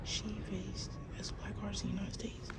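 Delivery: real hushed whisper in a gallery; soft museum whisper. Real, not acted. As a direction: whisper it, moved and amazed.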